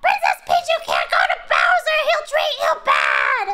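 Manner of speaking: high loud squeaky shouting; Toad impression. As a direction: panic as Toad, shrill and loud. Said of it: "Where's the Toad impression?".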